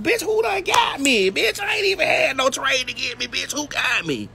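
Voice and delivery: high, hammed-up shout; imitating her own indignant shouting; mock outraged yell. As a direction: yell it up high like you've been wronged. Retold indignant outburst.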